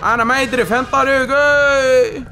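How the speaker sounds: loud, slow, high mock wail; wailing broken Arabic. (عويل بعربي مكسّر ممطوط)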